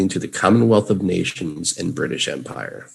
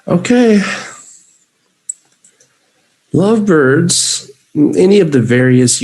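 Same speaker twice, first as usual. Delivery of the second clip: high excited; 10 semitones higher, 5 dB louder than usual. Real, not acted.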